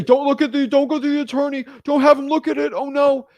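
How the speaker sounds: high, loud, frantic don't don't; sounding like a scammer. Retold or imitated. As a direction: beg them not to check it, panicking. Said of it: "you sound legit like a scammer".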